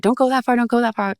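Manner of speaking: high, urgent pleading to stay back; imitating a worried boyfriend calling her back; nervous warning. Boyfriend nervous at the cliff edge, urgent high warning.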